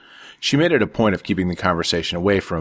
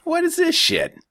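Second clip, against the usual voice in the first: much higher, grossed-out voice; disgusted younger brother. A narrator's reading. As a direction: spit it out like bad wine. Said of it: "He cringed".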